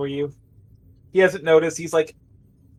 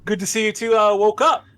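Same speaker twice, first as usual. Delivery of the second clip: higher-pitched, fussing medic voice; anxious-to-help medic, lifted voice; concerned medic; 7 semitones higher than usual. Acted. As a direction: check on waking patients, pitch climbing on the questions. Game master plays Mike.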